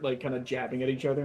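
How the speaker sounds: ordinary delivery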